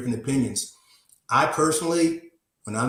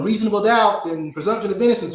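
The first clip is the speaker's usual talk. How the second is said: raised, higher mock courtroom yell; 7 semitones higher, 4 dB louder than usual. Lawyer mimicking himself arguing to a jury, mock yelling. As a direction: belt out the legal catchphrases in imitation. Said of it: "you know, yelling".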